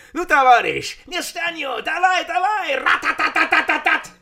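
high, loud hammed-up Russian commander with gunfire noise; mock Russian purge officer. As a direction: bark it like a caricature Russian officer, machine gun at the end. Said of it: "ist eine Tschüska". (spöttisch nachgemachter russischer Offizier, hoch und laut)

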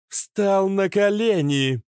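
high, loud, ringing commanding voice; ringing metallic voice. A narrator's reading. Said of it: "звенящим металлическим голосом".

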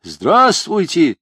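slow, loud shout into a horn. A narrator's reading. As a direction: yell it into the ear trumpet. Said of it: "прокричал я в рожок".

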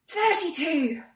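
Thirty-two! blurted high and loud; mock breathless shout. Mathematician bursting into the pub, shouting the number.

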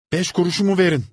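high, loud crying plea; tearful demand. A narrator's reading. Boy demanding his five kuruş. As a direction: insist on the money.

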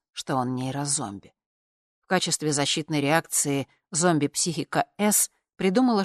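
ordinary delivery, nothing marked

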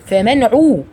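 عو said with the ayn rounded into a clear u; العين مضمومة في عو. Shown as a demonstration. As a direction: round the lips on عو, not عفي. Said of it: "شوفوا العين مضمومة إزاي".

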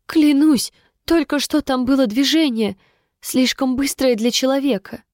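put-on high-pitched, scared young soldier; frightened insistence. A narrator's reading. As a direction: swear it urgently, desperate to be believed. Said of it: "испуганно зашептал юный солдат".

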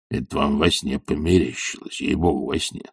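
soft, low denial; smooth it over quietly; placating. A narrator's reading. Servant denying his rudeness to the master, placating.

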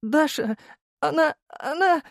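very high, whimpering; squeaky, broken lovesick sobbing; tearful stammer. A narrator's reading. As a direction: choke on the words, acting near tears. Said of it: "всхлипывал Володя".

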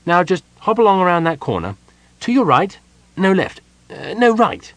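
high-pitched, busy instructions; brisk gnome. A narrator's reading. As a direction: give brisk directions, correcting yourself. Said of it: "said the gnome briskly".

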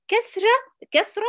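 excited teacher pitch; emphatic high naming. Shown as a demonstration. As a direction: lift كسرة high to call attention. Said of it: "نفس الفكرة بالظبط".

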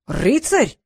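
outraged cry; indignant dragon; indignant exclamation. A narrator's reading. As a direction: exclaim with suspicion. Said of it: "возмущённо воскликнул".

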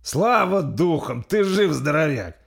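high, loud, elated voice; jubilant relief. Acted. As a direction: cry out joyfully to a friend who survived. Joy at friend's survival.

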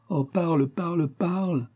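high, drawn-out plea; imploring lover. A narrator's reading. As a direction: beg him to speak, slowing on each repeat. Lover begs him to speak. (supplication amoureuse, voix haute et traînante)

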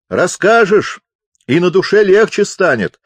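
high, loud cajoling; loud encouragement. A narrator's reading. Deaf chaplain coaxes the doubtful visitor, loudly encouraging. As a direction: cheer him on at full voice. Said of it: "подбадривал капеллан".

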